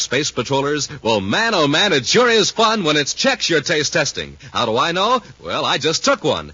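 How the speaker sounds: ordinary delivery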